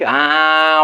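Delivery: ordinary talk, nothing marked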